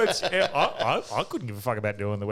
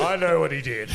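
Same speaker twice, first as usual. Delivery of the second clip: mock cricketer; 4 semitones higher, 7 dB louder than usual. Mimicking the doctor quote.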